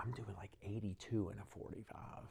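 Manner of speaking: whispered aside about speeding; half-whispered, sheepish admission; hushed confession. Real, not acted. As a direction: drop to a whisper as if admitting guilt.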